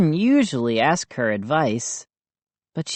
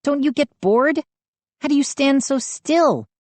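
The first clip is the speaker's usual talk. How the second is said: put-on girl voice; 11 semitones higher than usual. A narrator's reading. Mindy's questions.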